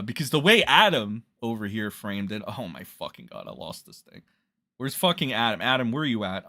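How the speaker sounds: ordinary talk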